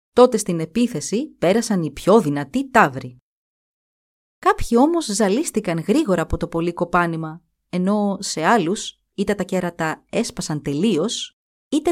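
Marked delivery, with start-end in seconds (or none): none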